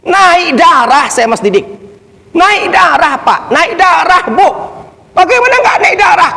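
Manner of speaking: high, forceful preacher's outrage; worked-up outrage. Retold or imitated. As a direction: press it on the listeners, pitch climbing. Preacher reenacts his blood boiling. (berapi-api, nada tinggi penuh geram)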